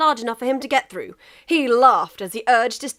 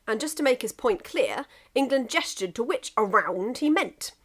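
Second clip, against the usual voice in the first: ordinary delivery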